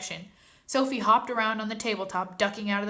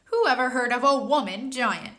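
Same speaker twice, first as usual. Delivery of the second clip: louder indignant giant shout; 4 semitones higher, 6 dB louder than usual. Acted. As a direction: bellow it at her. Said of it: "shouted the B F G".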